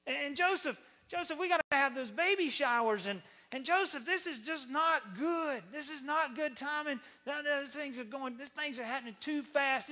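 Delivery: high, whiny, insistent voice; pleading wife. Acted. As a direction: plead in a hammed-up fretful wail. Acted wife pleading with Joseph.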